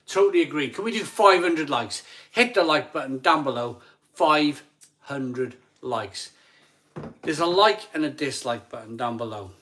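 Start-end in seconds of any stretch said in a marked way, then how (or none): 4.1-6.3 slow, stretched chant; drawn out. Real, not acted. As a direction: stretch each word out. Drawn-out rallying of likes.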